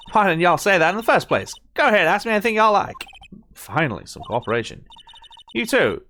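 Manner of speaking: put-on brisk friendly Southern accent; cheery mock Southern woman; Southern drawl. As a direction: invite them in a hammed-up drawl. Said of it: "exaggerated drawl".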